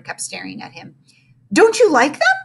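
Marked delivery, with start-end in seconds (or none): 1.5-2.4 surprised question. Acted. Teacher's hurt high question.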